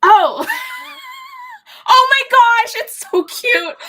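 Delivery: loud high delighted squeal. Real, not acted. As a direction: shriek with delight at the reveal. Reveal of the crocheted bunnies.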